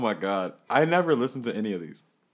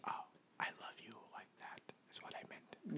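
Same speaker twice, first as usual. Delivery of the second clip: whisper; 2 semitones higher, 21 dB quieter than usual. Acted. Teasing whispered banter.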